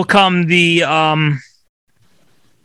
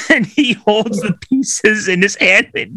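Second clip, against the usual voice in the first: ordinary talk, nothing marked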